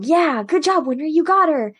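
high, swooping cheer for a friend. Retold or imitated. Ed and Al congratulate Winry, high acted cheer. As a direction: imitate excited kids congratulating someone.

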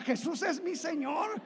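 high, laughing chant of the crowd; laughing imitation. Preacher mimicking the whole church with a laugh. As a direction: echo the congregation, laughing. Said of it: "todos comenzaron a".

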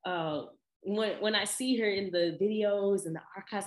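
ordinary talk, nothing marked

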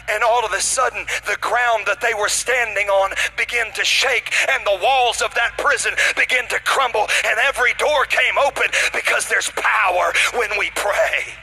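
loud, emphatic preacher proclamation; fired-up sermon delivery; full-force preaching. Real, not acted. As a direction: proclaim the miracle at full volume, stretching the climax.

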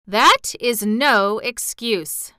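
slow, cold and firm; coldly. A narrator's reading. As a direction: lay it down icily, word by word. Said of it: "declared the prince coldly".

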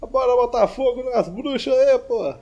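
high, loud joking holler; mock rallying cry. Host jokingly urging everyone to burn witches, loud rallying shout. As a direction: rouse a mob in jest.

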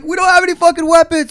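loud, high frantic protest; panicked yelling back. Retold or imitated. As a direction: shout it back at armed police. Kids yelling back at police.

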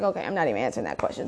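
low, fast, fed-up brush-off; dismissive and quick; exasperated. Retold or imitated. Exasperated reply to boyfriend.

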